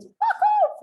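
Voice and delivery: scream. Retold or imitated. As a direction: scream it out as if someone made you jump. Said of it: "I screamed".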